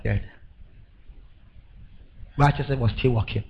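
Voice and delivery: ordinary talk, nothing marked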